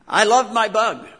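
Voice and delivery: loud, high, "loved" stretched out; proud burst of love for the Bug; fond exclamation. Real, not acted. Fond memory of his car.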